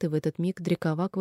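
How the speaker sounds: plain reading voice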